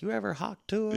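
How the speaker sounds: high put-on Kamala voice; lightly voiced Kamala Harris; Kamala impression. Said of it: "she's like".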